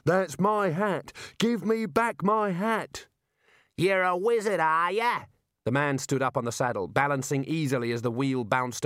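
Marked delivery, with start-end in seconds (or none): 0.0-3.1 high, slow, indignant cry; outraged demand. Acted. Wizard demands stolen hat. 3.8-5.2 high, called-out taunt; gleeful raider mocking from alongside; jeering question. Raider jeers at wizard.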